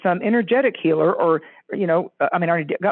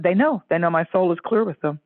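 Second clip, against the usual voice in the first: usual speaking voice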